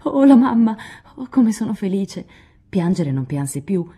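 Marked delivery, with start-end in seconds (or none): 0.0-2.3 crying out with joy, louder and higher; joyful cry. A narrator's reading. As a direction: burst out in delight. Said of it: "gridando di gioia".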